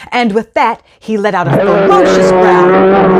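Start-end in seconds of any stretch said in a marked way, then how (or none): 1.2-3.2 narration turning into a louder growling bear. A narrator's reading. Sorcerer becomes a bear before the cat, growling narration.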